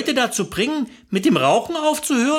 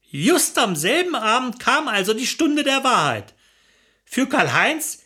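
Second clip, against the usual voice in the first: usual speaking voice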